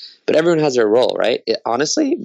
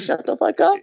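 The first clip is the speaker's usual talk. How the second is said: mocking his friend. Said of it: "and then you say".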